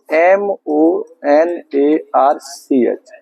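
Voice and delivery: very slow, one letter at a time; slow letter-by-letter spelling. Shown as a demonstration. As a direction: dictate the letters with space between each. Spelling the word out for students.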